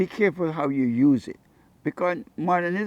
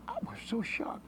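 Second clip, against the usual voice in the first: hushed shock; 8 semitones higher, 12 dB quieter than usual. Real, not acted. Amazement at the money.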